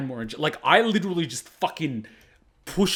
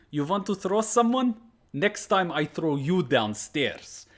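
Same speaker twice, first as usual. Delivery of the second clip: put-on higher Russian sensei accent, slowing to a menacing crawl; sensei's threat; 5 semitones higher, slower than usual. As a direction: imitate the sensei crouching down to threaten. Sensei threatening the squatting student.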